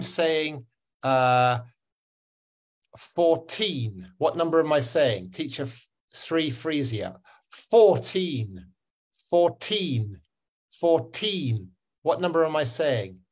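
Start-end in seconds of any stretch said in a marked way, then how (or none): 3.0-4.1 put-on fourteen with a long, falling TEEN; four-TEEN, deliberate teacher demo; stress on second syllable. Shown as a demonstration. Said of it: "is fourteen like that". 7.5-11.7 put-on fourteen repeated, TEEN drawn out and falling; four-TEEN, separate deliberate repeats; stress on second syllable. Shown as a demonstration. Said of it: "is fourteen like that".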